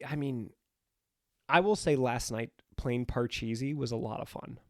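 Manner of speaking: usual speaking voice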